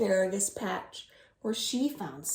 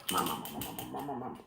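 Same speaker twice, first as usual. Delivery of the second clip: munching noises; 12 semitones lower, 7 dB quieter, much faster than usual. Pretend. Monster eating cookies.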